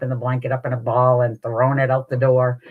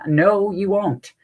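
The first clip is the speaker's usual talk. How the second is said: higher, stretched-out insistent refusal; emphatic refusal; 6 semitones higher, 4 dB louder, slower than usual. Retold or imitated. Mom overruling uncle's tarantula promise, emphatically insistent. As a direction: drag out each word to shut it down.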